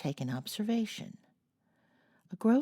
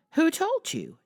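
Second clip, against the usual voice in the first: sharp, much higher and louder demand; 13 semitones higher, 6 dB louder than usual. A narrator's reading. Jo demands to know who told Laurie, high and loud. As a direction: snap the question out, high and loud. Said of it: "demanded Jo".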